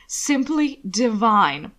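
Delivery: slow, drawn-out lofty "divine"; a slow, lilting society-lady pronouncement; self-important gush. Acted. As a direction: savour each word grandly. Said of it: "self-important cadence".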